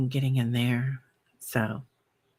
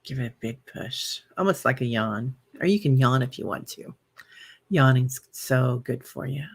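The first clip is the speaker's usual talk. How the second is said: guided breathing meditation. Acted. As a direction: talk the viewers gently through each breath.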